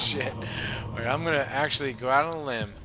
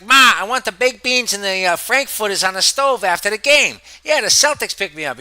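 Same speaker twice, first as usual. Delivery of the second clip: put-on higher, louder thick Boston accent; Dorchester Boston accent; 6 semitones higher, 10 dB louder than usual. Gamer mimicking the player's Boston accent, loud and high. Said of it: "He talks like this".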